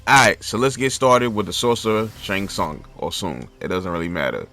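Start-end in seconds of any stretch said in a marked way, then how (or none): none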